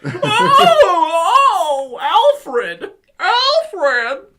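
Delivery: high, loud mock wail; hammed-up calling out. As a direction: wail the name theatrically. Hammed-up cry to Alfred.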